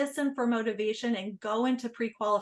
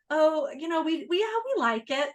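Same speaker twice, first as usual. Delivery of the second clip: unconvinced tone; 5 semitones higher, 3 dB louder than usual. Pretend. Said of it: "why she used that tone".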